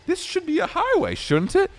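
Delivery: high, confused doubting voice; puzzled high question. Retold or imitated. On dark forest back roads, high puzzled voice. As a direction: wonder aloud if it's a highway. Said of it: "and I'm like".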